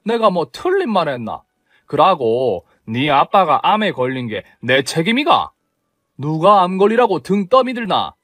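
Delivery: scornful Gyeongsang-dialect retort, pitched high; mother-in-law dismissing the daughter-in-law's outrage in Gyeongsang dialect. Acted. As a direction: throw it back at her in Gyeongsang dialect. Mother-in-law snaps back in dialect.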